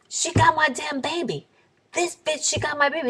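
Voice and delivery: high-pitched imitated accusation; mock accusing man. Voices the man accusing her to cops.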